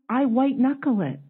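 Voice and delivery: usual speaking voice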